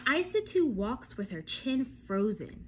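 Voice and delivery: usual speaking voice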